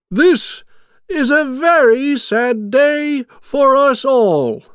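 very high, slow, drawn-out wail; loud, sad proclamation. A narrator's reading. Mayor tells the town of Jack's fall, loud sad wail. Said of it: "He spoke loudly".

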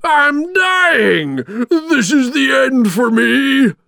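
loud, high, drawn-out wail; high-pitched villain howling his last words; dying villain's cry. A narrator's reading. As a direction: shout it as a melodramatic death cry. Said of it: "cried Oogie Boogie".

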